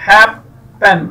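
first syllable of happen stressed, split from the second. Shown as a demonstration. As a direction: break happen into two beats, stressing the first. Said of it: "तो stress first पे है". (Happen के पहले syllable पर ज़ोर)

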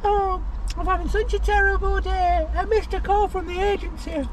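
squeaky falsetto complaining; high mock whiny voice. Vlogger imitates a dramatic diva in squeaky falsetto. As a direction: whine it in a squeaky put-on voice.

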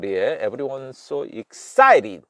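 ordinary talk

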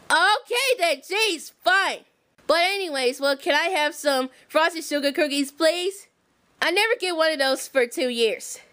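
deep motherly warning; Harriet voice. Pretend. Harriet voice again.

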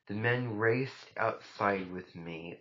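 ordinary delivery, nothing marked

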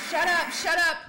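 loud panicked shut up; startled shout. Real, not acted. Gamer startled by the evil baby, shouting. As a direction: yell it in a fright.

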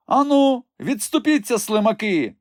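very high, loud crone scolding; furious old woman. A narrator's reading. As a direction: tear into the soldiers. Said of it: "накинулась вона на солдатів". (гнівний високий крик старої)

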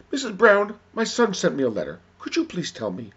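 high, loud call easing downward; pleading mother. Acted. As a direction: call out high to a neighbor, then ask. Asking second neighbor for help.

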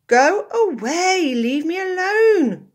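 slow, very high, loud wail; desperate cry. A narrator's reading. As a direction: beg them loudly to leave him be. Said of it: "cried Aardvark".

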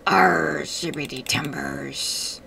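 low growly pirate, slowing on timbers. Pretend. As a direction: play a gruff pirate captain. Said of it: "I'm a pirate".

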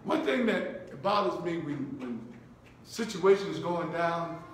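usual speaking voice

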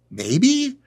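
high, wary little maybe; mock hesitant. Buyer asked for money for the seminar, mock hesitant. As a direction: squeak it out as a reluctant buyer.

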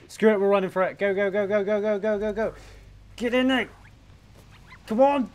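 loud, high frantic chase; frantic shouting. Real, not acted. Chasing a frog.

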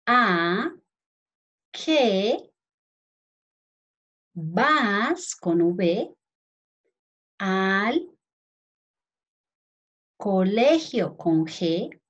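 words set apart by long pauses, spelling cues tacked on; teacher dictating a question; slow dictation. Shown as a demonstration. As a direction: dictate slowly so children can write each word.

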